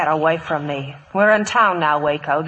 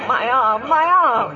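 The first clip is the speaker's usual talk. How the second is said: pained cry; 4 semitones higher, slower than usual. Acted. Lola just shot.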